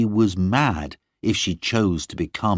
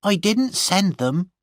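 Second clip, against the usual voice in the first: higher servant's voice; Gwenny's defiant voice; 12 semitones higher than usual. A narrator's reading. Gwenny admitting to her mistress, higher defiant voice. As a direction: deny it flatly as Gwenny. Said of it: "she said".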